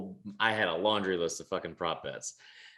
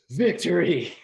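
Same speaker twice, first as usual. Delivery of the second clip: slow, louder, higher gloating; mock triumph; 8 semitones higher, 8 dB louder, slower than usual. Real, not acted. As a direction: savour the win, laughing. Mock triumph after joke.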